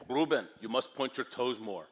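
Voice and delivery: put-on firm Schwarzenegger-like Austrian accent; Austrian coach. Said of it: "sounds like Arnold Schwarzenegger".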